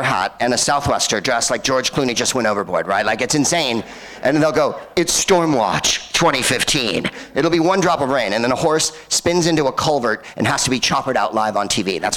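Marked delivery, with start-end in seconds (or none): 5.0-7.0 slow, drawn-out storm-report headline; mock TV reporter. Los Angeles reporter reacts to one raindrop, mock dramatic announcement. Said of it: "a reporter appears on television".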